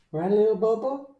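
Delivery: high cooing pet voice. Real, not acted. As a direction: coo at your puppy. Talks to his pet.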